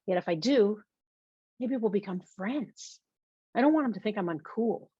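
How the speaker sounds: ordinary delivery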